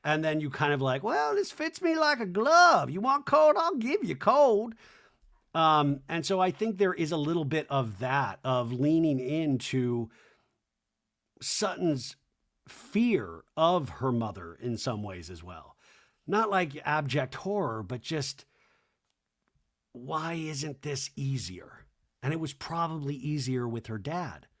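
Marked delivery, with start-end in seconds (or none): 1.0-4.7 high, slow, loud mock defiance; hammed-up high icy voice; mock cold lady. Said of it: "imitate this version". 19.9-21.9 quiet wondering. Retold or imitated. Voicing Sutton's quiet doubt.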